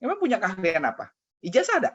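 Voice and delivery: high, loud mock interrogation; mother scolding. Mother grilling her son about his qualifications, high mocking scold. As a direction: grill him like a scolding mom.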